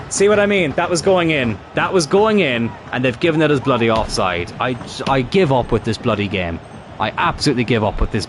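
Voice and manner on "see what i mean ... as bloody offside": higher, exasperated protest at a bad offside call; frustrated outburst. Real, not acted. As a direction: pitch it up in real disbelief. Glitch rules his goal offside.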